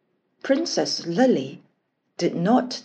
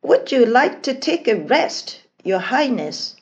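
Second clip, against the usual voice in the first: higher, politely voiced servant's question; respectful; 5 semitones higher, 4 dB louder than usual. A narrator's reading. Servant asking the princess to rest, respectful tone. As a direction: offer it deferentially, pitched up at first. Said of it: "asked in a respectful tone of voice".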